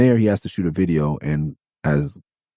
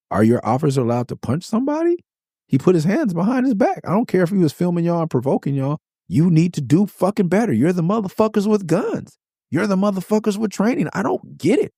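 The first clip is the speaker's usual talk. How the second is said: high-pitched, exasperated outrage; indignant rant; 13 semitones higher than usual. Real, not acted. Addressing police chiefs about an officer's punch, indignant and high-pitched. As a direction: rant in real outrage, pitch way up without shouting.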